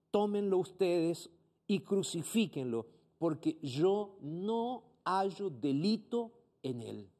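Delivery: slow, lightly voiced Pilate; a hint of Pilate, slowly handing him over. Pastor reads Pilate to the priests, slow and lightly voiced. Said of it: "Pilato les dijo".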